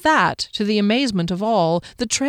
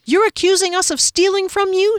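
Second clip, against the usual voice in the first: far higher indignant question; outraged disbelief; 12 semitones higher, 4 dB louder than usual. Acted. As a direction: companion indignant at accusation. Indignant retort.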